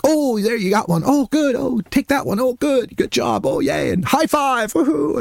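high-pitched playful praise; encouraging cheers. Retold or imitated. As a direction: cheer a small child on. Retells cheering on child's game.

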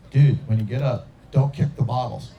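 low, deliberate heads-up to a friend; buddy's warning. Retold or imitated. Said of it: "my buddy's like".

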